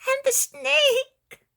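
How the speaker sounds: put-on very high, slow, tearful child's question; a small boy scared of the snake; frightened child.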